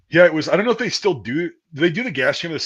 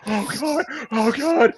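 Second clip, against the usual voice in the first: higher voice, moaning in misery; mock groaning; 11 semitones higher, slower than usual. Recruit recovering from gas, high miserable groan. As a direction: groan like you're still gassed. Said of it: "I'm like".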